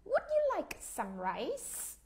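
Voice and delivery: slow, rising polite question; mother offering. Retold or imitated. Mother offering her children rice, lightly voiced. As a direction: offer it gently like a mother at dinner. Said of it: "bà mẹ bà nói câu gì nè".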